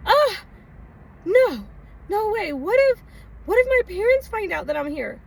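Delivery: worried about her parents; mock-terrified young self; panicked refusal. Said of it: "But I was like".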